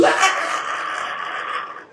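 a sudden high cry of relief; joyful shout. Retold or imitated. Passing the audition in front of Milton, joyful shout. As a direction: yell out, overjoyed at passing. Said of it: "Eu gritei".

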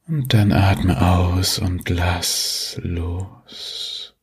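drawn-out calming release; very slow. Acted. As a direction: stretch every word out slowly.

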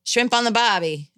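Australian accent. Shown as a demonstration. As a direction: draw out a put-on Australian accent. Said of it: "find a good word".

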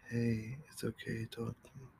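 put-on soft, hushed coaxing of a scared child; gentle coaxing. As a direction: ease the little ghost out quietly.

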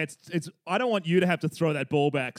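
ordinary talk

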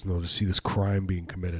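normal talking voice

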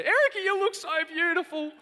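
high, loud, gushing praise; gushing friend again. Retold or imitated. Ruth gushing over the wife's dress at the wedding. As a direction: gush over her like a delighted old friend. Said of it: "And she goes".

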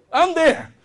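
high, loud eager yes; hammed-up eager seller; overeager salesperson. Shown as a demonstration. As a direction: jump at the chance. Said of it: "so eager, excited".

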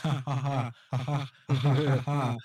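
mock "ha ha ha" laughter; fake laughing. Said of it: "Just laughing through it".

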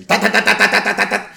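loud, high, rapid-fire jackhammer noise; imitated jackhammer. Loud radio commercial blaring at a driver, mimicked jackhammering. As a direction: hammer out hard, even staccato bursts. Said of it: "she starts hearing jackhammering, like, really, really loud".

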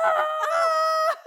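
high loud mock shriek; shrieking scream. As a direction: let out a squeal of fright.

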